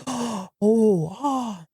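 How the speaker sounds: a hammed-up shocked gasp into a small oh, oh; mock courtroom gasp. Courtroom crowd hears about the knife, mock shocked gasp. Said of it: "everybody's gasping".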